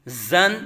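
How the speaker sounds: زن with a firm, sharp, buzzing whistle-like z; hard whistling Z. Shown as a demonstration. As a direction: press a strong z into زن. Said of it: "سختی سے سیٹی کی طرح تیز آواز".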